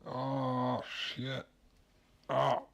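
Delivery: pained, stinging-eyed groans; teary from chopping onions. Real, not acted. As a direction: wince through onion-sting tears. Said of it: "these onions are getting me".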